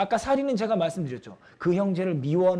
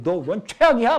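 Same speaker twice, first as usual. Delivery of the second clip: louder, higher secret verdict; mock inner contempt; 6 semitones higher, 6 dB louder than usual. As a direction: blurt the hidden insult. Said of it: "막 이런 속으로는". (속마음을 터뜨리듯 크게)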